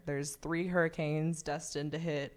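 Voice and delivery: ordinary delivery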